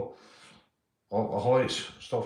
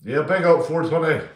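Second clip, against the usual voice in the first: loud shout-out; 6 semitones higher, 10 dB louder than usual. Real, not acted. Shouting out a chat viewer.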